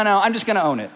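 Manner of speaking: ordinary talk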